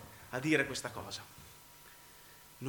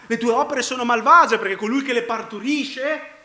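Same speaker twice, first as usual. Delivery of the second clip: raised, loud pulpit accusation; full-force preaching; 6 semitones higher, 8 dB louder than usual. Real, not acted. Preacher accusing the listener of evil works, full-force preaching. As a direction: press the charge on the listener.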